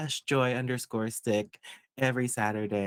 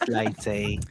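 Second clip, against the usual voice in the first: Lady Lay impression; 3 dB louder than usual. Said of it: "our best Lady Lay impression".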